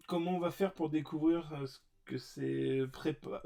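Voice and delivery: ordinary talk, nothing marked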